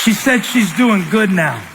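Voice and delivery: high, loud and slow, ringing out; triumphant shout. Real, not acted. Preacher shouting the good news to the church. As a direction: announce it in triumph.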